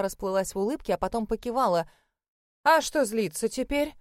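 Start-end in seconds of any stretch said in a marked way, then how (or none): 2.6-3.9 innocent. Acted. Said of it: "невинно спросила она".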